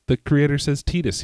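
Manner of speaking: "Tidus" with an ee, like tea; first syllable of "Tidus" rhymes with tea; TEE-dus pronunciation. Shown as a demonstration. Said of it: "Some people say Tidus".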